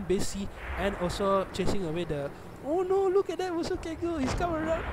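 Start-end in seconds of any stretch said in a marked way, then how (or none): 2.6-4.8 high-pitched excited cry; alarmed excitement. Real, not acted. Commentator spotting a sudden flank, high excited alarm. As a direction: voice leaps way up in alarm.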